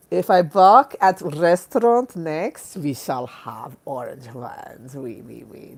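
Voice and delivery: put-on slow, muddled French-German accent. Promising orange wines at a restaurant, mock French-German accent. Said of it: "That was some weird French German accent".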